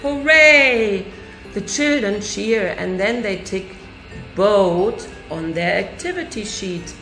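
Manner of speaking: ordinary delivery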